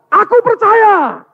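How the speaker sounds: drawn-out, very high cry of belief; desperate shout. Acted. Desperate father answering Jesus, drawn-out high shout. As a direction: shout it slowly at the top of the voice. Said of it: "Terus dia teriak".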